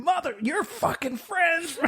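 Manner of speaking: high-pitched mock yell at the boyfriend; retold yelling. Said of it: "Yelling at him".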